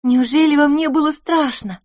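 восторженный вопрос девочки, выше и громче; ахнуть от восхищения перед героем; с восхищением. A narrator's reading. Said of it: "спросила Пипина с восхищением". (с восхищением, высоко и звонко)

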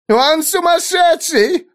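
high, slow wailing woman. A narrator's reading. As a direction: wail in panic. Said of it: "взвыла какая-то женщина".

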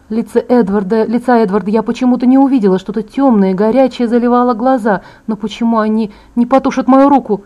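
usual speaking voice

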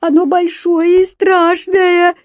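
put-on high, loud, slow crying child; tearful wail. A narrator's reading. Еля warning papa about the monster, tearful wail. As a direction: wail it out in drawn-out, tearful fright. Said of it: "заплакала Еля".

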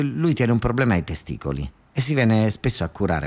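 ordinary talk

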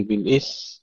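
بالإث ending on th, no m; dropped mim. Shown as a demonstration. Teacher showing the mistake, final mim lost in بالإث. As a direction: wrongly cut the word after ث in بالإث. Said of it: "ونظهر الميم".

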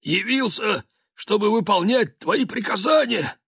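high hoarse servant voice. Acted. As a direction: croak like a man with a cold. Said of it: "простуженным голосом".

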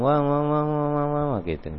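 high, soft imitated crying "wah-wah"; mock wailing babble. Shown as a demonstration. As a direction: drone a pretend wail on one high note. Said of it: "nangisnya sambil ngomong".

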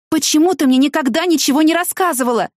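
высокий упрекающий выкрик; кричи с обидой на высоком тоне, не прибавляя громкости; возмущённый крик. A narrator's reading. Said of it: "закричала я на неё". (возмущённо)

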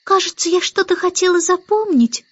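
put-on high, puzzled little-girl voice; frowning baby Annabel. A narrator's reading. Baby Annabel struggles to remember, high puzzled voice. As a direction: puzzle aloud in a child's voice. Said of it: "нахмурившись".

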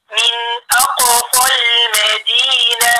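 slow recitation, أقصى linked short into المدينة; madd dropped in joining. Shown as a demonstration. Teacher repeating the example, slow linked recitation. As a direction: chant من أقصى المدينة with no long aa before lam. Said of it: "المد يسقط".

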